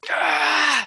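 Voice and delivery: high, loud cry of burning pain; sudden high shriek of pain; sharp pained yelp. Acted. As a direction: yelp as the hand is scorched. Hand burning in the water.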